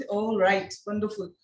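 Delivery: usual speaking voice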